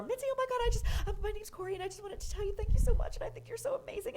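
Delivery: breathless-fast, very high-pitched fangirl gush. Retold or imitated. New comic running up to meet the club owner, gushing. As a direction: gush all over an idol in a high voice. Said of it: "I was just gushing all over her".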